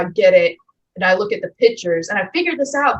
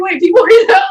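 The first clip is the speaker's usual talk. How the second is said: incredulous shout; 11 semitones higher, 9 dB louder than usual. Retold or imitated. Mock disbelief at instructions.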